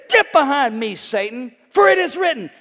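loud, high rebuke of the devil; commanding shout. Acted. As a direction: shout the scripture at the tempter. Preacher acting Jesus rebuking devil.

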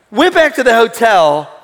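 normal talking voice

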